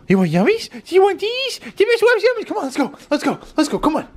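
very high squeaky pet voice, eager. Real, not acted. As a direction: hype the dog up for treats. Offering treats to the dog.

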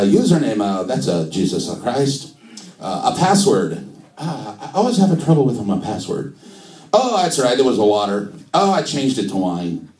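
put-on comic Italian accent; sing-song mock Italian; Italian-accented Jesus. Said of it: "do Jesus in an Italian accent".

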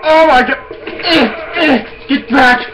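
high frantic yelling; panicked shouting. Real, not acted. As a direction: scream at the monster. Fighting enemy in panic.

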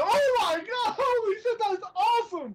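high-pitched fanboy amazement; excited reaction. Retold or imitated. Viewer retelling his reaction to the sketch, excited. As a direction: squeal it like a thrilled viewer. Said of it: "I'm like".

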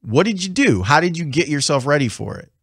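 ordinary talk, nothing marked

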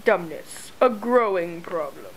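low, quiet mock PSA voice; deadpan voice-over tagline; low mock commercial narrator; mock announcer line.

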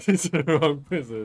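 blurted through a loud laugh; cracking up mid-line. Real, not acted. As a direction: laugh out the correction. Player laughs at wrong target.

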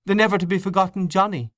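high, quick, amused exclamation; mild merriment in a lifted voice; laughing. A narrator's reading.

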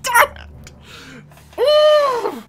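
very high, loud curse; frustrated scream. Real, not acted. Player cursing a loss, frustrated scream.

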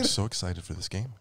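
very soft, understated presenter voice; Mark Cerny impression. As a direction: claim excitement in a hushed, measured way. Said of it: "Mark Cerny's voice".

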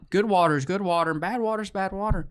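normal talking voice

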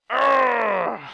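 loud, high growl of frustration; real exasperated groan; frustrated groan. Real, not acted. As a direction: let out an angry urgh. Frustrated at losing items.

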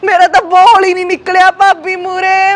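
ordinary delivery

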